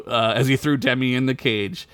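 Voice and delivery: ordinary talk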